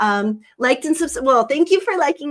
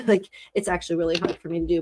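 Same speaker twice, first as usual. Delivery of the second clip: normal talking voice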